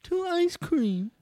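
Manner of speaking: very high, slow mock British lady; loud falsetto British woman, drawn out; British woman accent. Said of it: "a woman with a British accent".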